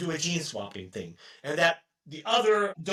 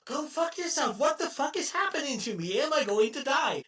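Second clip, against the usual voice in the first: mock angry rant; 9 semitones higher than usual. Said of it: "And he's like".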